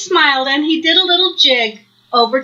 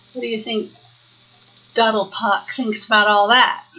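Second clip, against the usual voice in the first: ordinary delivery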